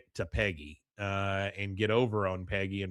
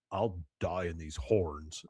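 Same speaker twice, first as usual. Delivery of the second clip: low, slow declaration in character; stubborn Dooley; 6 semitones lower, 5 dB quieter, slower than usual. Retold or imitated. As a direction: imitate a defiant refusal, pitched down and drawn out. Retelling Dooley refusing to remove hat.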